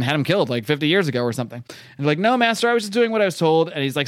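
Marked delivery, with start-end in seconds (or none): 2.2-3.7 high, cowering mock protest; hammed-up servile excuse; pleading minion. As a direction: beg the master in a higher voice. Minions pleading with their master.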